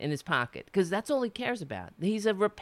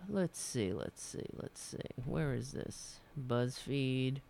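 muttering to self; 5 semitones lower, 10 dB quieter, slower than usual. Real, not acted. Searching for article, muttering.

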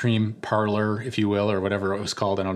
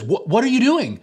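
high, loud shocked outburst; a dad catching his kid red-handed; 11 semitones higher, 5 dB louder than usual. Retold or imitated. Dad to son licking his sister's cone, shocked high loud outburst.

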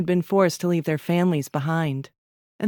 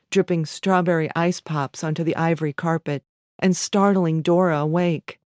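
ordinary delivery

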